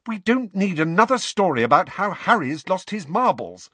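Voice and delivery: high, loud angry retort. A narrator's reading. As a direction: snap the line back at her. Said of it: "said Hermione angrily".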